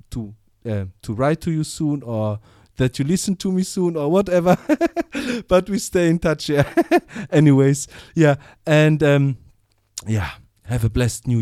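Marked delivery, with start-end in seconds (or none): 4.6-5.4 laugh. Real, not acted. Host laughing at own muddle. 6.7-7.3 laugh. Real, not acted. Host laughing at own muddle.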